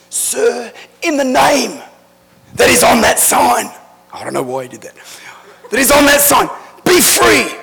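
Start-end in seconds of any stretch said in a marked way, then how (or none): 0.1-3.7 imitating a preacher's loud, high-pitched command; commanding deliverance shout. Dad commands deliverance, acted in story. 5.7-7.6 imitated preacher's shout, high and loud; commanding deliverance shout. Dad's command resumed after aside.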